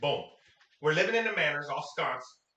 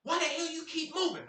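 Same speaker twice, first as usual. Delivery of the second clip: retold yell; 11 semitones higher than usual. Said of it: "so she yelled".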